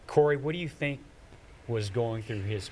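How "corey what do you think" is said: ordinary talk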